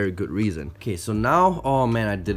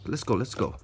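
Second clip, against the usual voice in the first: normal talking voice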